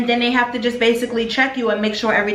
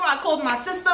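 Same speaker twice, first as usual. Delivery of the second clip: ordinary talk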